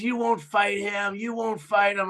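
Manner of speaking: imitating a heckler's goading; retold taunt.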